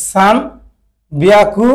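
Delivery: সান বিয়াকু in two slow, separated parts; slow pronunciation demo. Shown as a demonstration. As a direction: say সান, pause, then draw out বিয়াকু. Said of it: "এই উচ্চারণটা এমন মতো আসে". (সান বিয়াকু ধীরে, থেমে থেমে উচ্চারণ)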